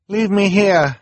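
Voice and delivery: high, loud insistent call; insisting. Acted. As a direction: insist firmly, calling out from afar. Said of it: "she insisted".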